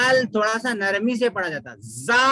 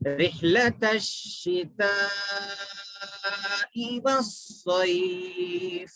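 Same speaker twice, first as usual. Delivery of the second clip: slow drawn-out melodic chant; Quranic recitation; much slower than usual. Shown as a demonstration. Teacher recites to students, slow tajweed chant. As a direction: recite it slowly with long held vowels.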